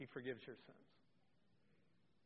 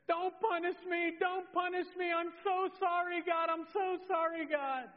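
put-on high, loud wail of a pleading child; hammed-up tearful begging, pitched way up; imitated crying; 12 semitones higher, 5 dB louder than usual. Young daughter upstairs begging God not to punish her, mock crying. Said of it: "I start hearing this crying from upstairs".

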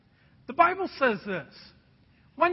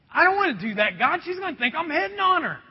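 higher, hurried mock pleading; reluctant protest; 6 semitones higher, 4 dB louder, faster than usual. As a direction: argue back to God, rising in alarm. Protesting to God, retold.

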